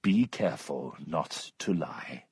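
low, quiet, cold caution; icy restraint, voice dropped; cold warning. A narrator's reading. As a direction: warn them coldly under your breath. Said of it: "said Mister Allworthy coldly".

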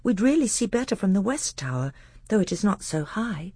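high-pitched boy prince, lightly acted; eager young prince. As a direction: pitch it up like an earnest young pupil. Narrator voices young Caspian.

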